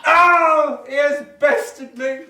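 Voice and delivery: mock death. Mock stage duel death.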